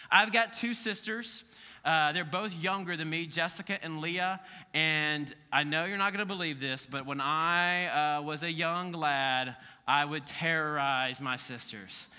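ordinary talk, nothing marked

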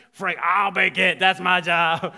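very loud, forceful claim; scary mock bellow. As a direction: bellow it like a gruff cook staking a claim. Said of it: "That's kinda scary".

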